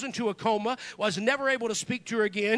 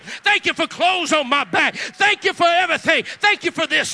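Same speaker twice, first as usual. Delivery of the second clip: loud, higher, building thanks to God; fervent shouted prayer; 4 semitones higher, 8 dB louder than usual. Acted. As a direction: pour out thanks at full volume, each one bigger.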